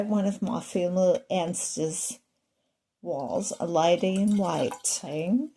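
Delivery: mock-posh English accent on "lady in waiting". Shown as a demonstration. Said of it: "I come by my English accent quite rightly".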